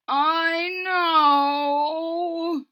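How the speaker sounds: sick crying moan; weepy sick moan. Acted. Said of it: "moaned Wilbur".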